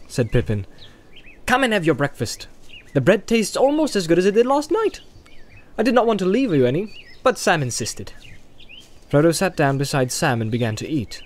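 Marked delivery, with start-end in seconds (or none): 1.4-8.1 put-on high, quick, chirpy young hobbit. A narrator's reading. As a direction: play the eager young Pippin. Narrator voices young hobbit Pippin.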